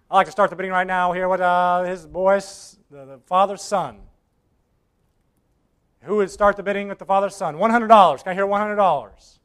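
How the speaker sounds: quick, brisk auctioneer call. Acted. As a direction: rattle off the opening bid. Auctioneer opens the bidding.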